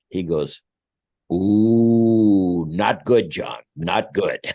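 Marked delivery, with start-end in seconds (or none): 1.3-4.4 loud "Ooh" then a slower, lightly voiced "not good"; lightly mock the lawyer's grim verdict; imitating the attorney. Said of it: "what an attorney goes".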